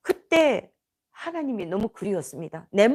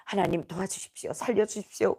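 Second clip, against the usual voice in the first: quiet, lower-pitched retold plea; retold prayer; 4 semitones lower, 6 dB quieter than usual. Speaker recalls praying for rescue in prison, subdued plea. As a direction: plead softly in a subdued prayer. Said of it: "기도했습니다".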